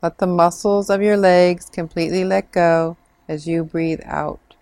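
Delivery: normal talking voice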